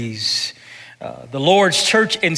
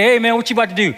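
casual greeting; 7 semitones higher, 3 dB louder, much faster than usual. Acted. Acted greeting between workers.

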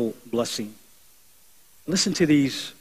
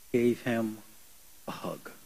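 usual speaking voice

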